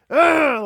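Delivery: a loud, high, frustrated ugh; frustrated groan. Retold or imitated. Father imitating his daughter stuck on Legos, groaning. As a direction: groan it like a fed-up child. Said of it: "screaming at the top of her lungs and crying".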